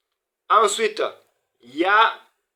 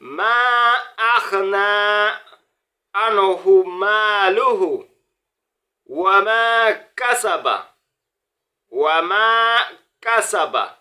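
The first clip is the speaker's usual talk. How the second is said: higher, louder recited verse; Quran recitation; 5 semitones higher, 4 dB louder than usual. Acted. Teacher recites the verse whole to students. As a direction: intone the verse in one flow.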